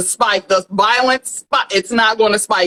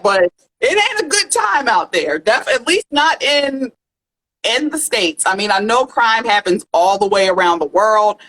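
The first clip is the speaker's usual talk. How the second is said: ordinary delivery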